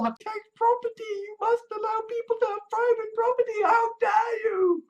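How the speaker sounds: high, shouted, sarcastic indignation; mock outrage. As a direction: ham up a pearl-clutching scold. Mock outrage at pro-property critics.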